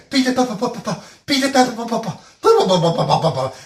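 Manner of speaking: high, loud, stammering attempt at the name; stumbling over the name. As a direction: trip over the syllables, a mock struggling man. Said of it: "he started to pronounce it".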